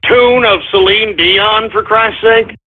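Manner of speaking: usual speaking voice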